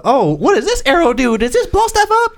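very high put-on squeal of questions; mock a gushing question in falsetto; high, silly mimicking voice; mocking fan voice. Mock quote of Hawkeye fan.

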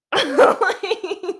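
big, high burst of laughter; loud high laugh. Real, not acted. Laughs about not wearing bra.

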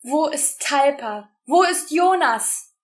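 high, loud sobbing questions. A narrator's reading. Rosa sobbing for her missing friends, loud tearful cries. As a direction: cry out between sobs. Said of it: "ergänzte sie schluchzend".

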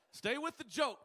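high, loud call to attention; mock scolding. Preacher to a tough crowd mid-joke, mock-scolding shout.

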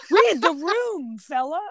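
slow, laughing mock plea; half-laughing exasperation. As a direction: drawl it through a laugh. Mock advice to banker, laughing.

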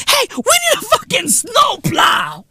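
high, shouted mock boast; hammed-up bragging shout; mock bragger shout. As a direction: yell it like an overexcited show-off. Mocking a bragging snow tourist.

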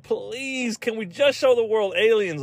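high, wheedling mock plea; pleading. Mexico begging to show off aliens, high mock pleading.

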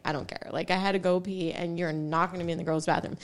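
low, soft, unbothered reply; quiet, dismissive retort; retold indifference. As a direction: shrug it off under your breath. Retelling her unbothered reply.

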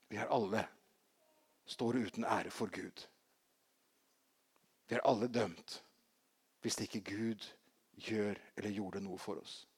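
usual speaking voice

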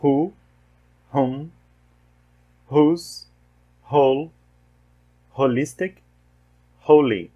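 "Who", "whom", "whose", "whole", "holistic", "holy" with a breathy H and silent W; teacher modelling the aspirated H in "Who" and "holy"; English W H words said as H. Shown as a demonstration. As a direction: model each word slowly for students, W silent. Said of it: "Mais uma vez".

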